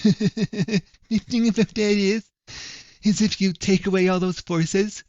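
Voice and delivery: high, slow, laughing mockery of the critic; mocking imitation. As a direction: drag the words out high to ridicule him.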